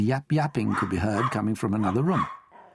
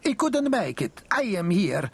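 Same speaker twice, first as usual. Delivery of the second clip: high, slow, indignant nonna; high old lady voice, slow and put out; huffy Italian grandmother; 12 semitones higher, slower than usual. Acted. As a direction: brush the question off with a huff. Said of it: "Mighty Mama huffed".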